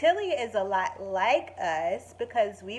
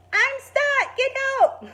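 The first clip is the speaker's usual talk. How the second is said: high, loud cry from up a tree; stuck child calling; 7 dB louder than usual. Acted. Girl stuck in her favorite tree, loud high call.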